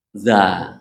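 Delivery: Za with the tongue tip pushed forward against the upper teeth; za sound. Shown as a demonstration. Teacher isolating the letter for learners, a careful demo. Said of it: "Kalau za".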